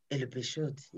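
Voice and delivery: ordinary delivery, nothing marked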